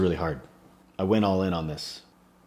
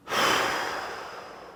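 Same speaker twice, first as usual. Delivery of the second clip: long unvoiced emotional exhale; long sigh. Real, not acted. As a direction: let out a heavy sigh. Emotional sigh after son's words.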